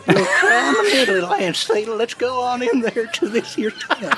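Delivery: put-on old prospector. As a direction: play a grizzled old prospector with a laugh. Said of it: "You're, you're a winged old prospector".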